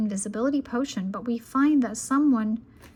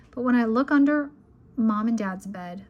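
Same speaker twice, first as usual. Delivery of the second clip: plain reading voice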